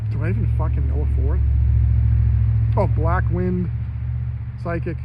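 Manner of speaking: loud, fast exasperated swearing; loud exasperated outburst. Real, not acted. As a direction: blurt it out loud in frustration. Frustrated outburst naming people.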